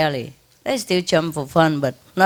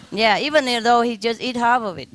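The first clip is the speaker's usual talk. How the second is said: usual speaking voice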